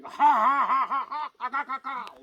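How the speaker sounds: a loud, high cockerel cackle; crowing laugh. Acted. Cockerel on the stone mocking the fleeing Atholl men, crowing laugh. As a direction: crow out a triumphant laugh like a rooster. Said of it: "started crowing ... laughing at the Atholl men".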